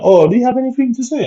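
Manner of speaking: high, quick, sugary imitation of the nurse; lightly voiced nurse, fake-friendly and fast; mock sweet nurse. Nurse questioning the patient on his return, in a high mock-sweet voice. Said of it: "The nurse was like".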